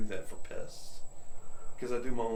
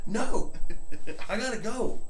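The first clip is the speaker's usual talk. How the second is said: high, louder retold brush-off; emphatic refusal; 10 semitones higher, 5 dB louder than usual. Homeowner shutting down a pushy salesman, emphatic. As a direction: cut the salesman off, pitch jumping up.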